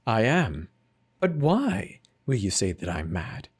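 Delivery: ordinary delivery, nothing marked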